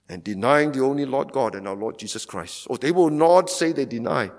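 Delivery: ordinary delivery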